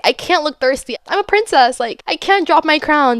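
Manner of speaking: high-pitched, hammed-up haughtiness; princess airs. Inching closer but refusing to look thirsty, princess airs. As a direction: play the precious princess, voice lifted.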